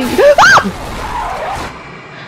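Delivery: high, breathy shriek of fright; gasp-scream at the jumpscare. Real, not acted. Player hit by a jumpscare, screaming. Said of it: "I popped your ears".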